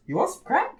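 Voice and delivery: soft, high, casual offer; calm nonchalance. Retold or imitated. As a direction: imitate an unbothered dealer, light and high. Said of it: "so calmly and nonchalantly".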